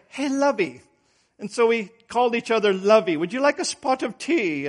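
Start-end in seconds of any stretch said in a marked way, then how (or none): 0.1-0.8 put-on light British aristocrat accent, a little higher and softer; playfully mock a British aristocrat's endearment; a hint of a British upper-class accent, teasing the wife. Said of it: "we were imitating, uh, British aristocrats". 3.2-4.7 mock British aristocrat accent, higher and softer; lightly hammed-up British aristocrat accent. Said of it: "we were imitating, uh, British aristocrats".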